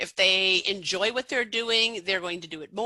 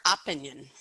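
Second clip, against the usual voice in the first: "opinion" stressed on the first syllable, like OP-in-yun; misplaced first-syllable stress; 6 semitones lower than usual. Shown as a demonstration. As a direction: hit the opening O of "opinion" and weaken the rest. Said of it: "well, the word was opinion".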